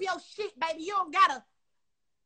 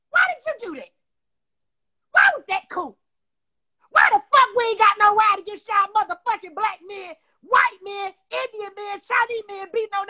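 furious, louder, pitch climbing on each why; angry shouting; 2 semitones higher, 7 dB louder than usual. Real, not acted. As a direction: yell the questions at him in real anger. Angry rant at a rapper.

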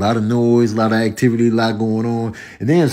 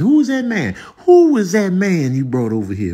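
mildly hammed-up nosy family member, higher-pitched; mock prying, rising high on the first question; nosy relative; 7 semitones higher than usual. Nosy relative asking about your date, prying.

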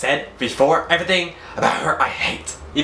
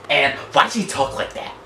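normal talking voice

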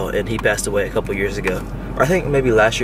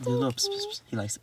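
ordinary delivery

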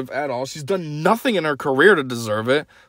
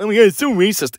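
higher-pitched whiny mockery; mock babyish complaint; 6 semitones higher, 3 dB louder, faster than usual. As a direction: imitate an oversensitive complainer. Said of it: "be such a little baby".

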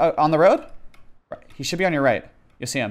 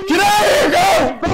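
very high, loud yell; frantic shout; 5 dB louder, slower than usual. Real, not acted. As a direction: scream at them to get away. Urging teammates to flee.

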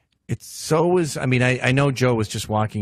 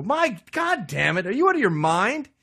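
outraged president; 9 semitones higher than usual. Retold or imitated. President scolding the intruder, high indignant outburst. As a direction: scold him in shock. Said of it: "you go".

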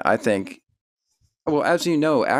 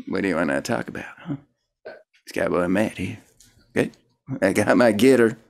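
lightly put-on cowboy character; Cowboy Matt; 3 semitones lower, faster than usual. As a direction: ease into a laid-back cowboy. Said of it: "I got this character named Cowboy Matt".

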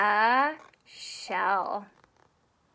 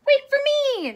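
high, loud calling out; excited yelled line; shout; 8 dB louder than usual. A narrator's reading. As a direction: call out to catch up. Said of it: "shouts Chris".

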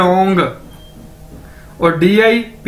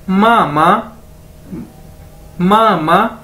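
slow spelled-out mama; much slower than usual. Shown as a demonstration. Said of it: "इसी तरह मामा".

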